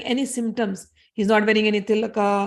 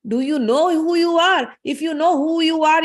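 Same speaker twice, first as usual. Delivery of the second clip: emphatic challenge; 5 semitones higher, 5 dB louder than usual. Retold or imitated. Mad sage challenging Parshuram, emphatic raised voice. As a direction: press each word on the listener.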